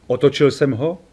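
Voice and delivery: ordinary delivery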